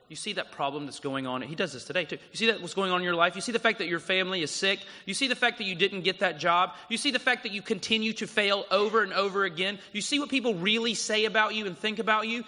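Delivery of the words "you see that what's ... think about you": higher, louder, fast accusing taunts; mounting sermon cry of the serpent's lies; serpent's taunts, building. Acted. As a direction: hammer the listener as the tempter. Preacher voicing the serpent, building.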